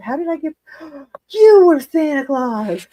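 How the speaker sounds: put-on high gasped child voice, slowly dawning; child's realization. Daughter realizing mom was Santa, high gasping wonder. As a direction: gasp then say it slowly in a small girl's voice. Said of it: "She said".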